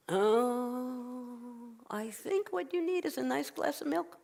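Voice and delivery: high, soft soothing voice; kindly rabbi. Acted. Rabbi suggests milk to sleepless woman, soothing. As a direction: draw out the oh, then coo the advice.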